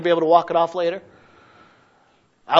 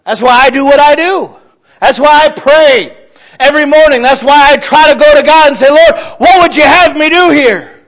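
louder and higher, every "That's why" hammered home; full-force preaching; 9 semitones higher, 9 dB louder than usual. Acted. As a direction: preach it out, rising to a cry to the Lord.

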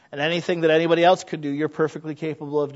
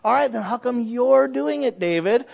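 ordinary talk

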